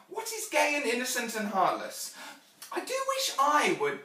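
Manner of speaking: put-on high, loud little-girl voice; inquisitive child Jane. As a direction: wonder aloud like an eager child.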